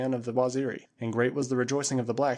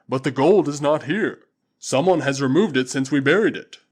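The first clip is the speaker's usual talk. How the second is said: booming Waziri warrior; 2 semitones higher, 9 dB louder than usual. Acted. Usula answering his master Tarzan, loud and proud. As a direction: call it out like a loyal tribesman. Usula says gold is gone.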